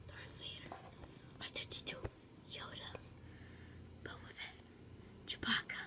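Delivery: soft whisper naming toys; whispering while hiding. Real, not acted. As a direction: whisper so nobody hears. Showing toys quietly, hiding.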